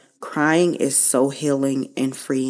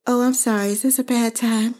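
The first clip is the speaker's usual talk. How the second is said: much higher put-on mom voice, sweetly innocent; imitating mom; 7 semitones higher than usual. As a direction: playfully mimic her mother's mock apology. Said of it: "And she would say".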